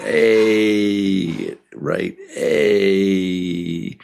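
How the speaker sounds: "A" drawn out low and long; extra long A. Shown as a demonstration. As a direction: stretch the "ay" as long as you like. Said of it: "You can make it longer".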